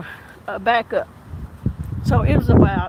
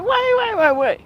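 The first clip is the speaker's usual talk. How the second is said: retold urgent plea; 10 semitones higher, 3 dB louder than usual. Stopping angry patient.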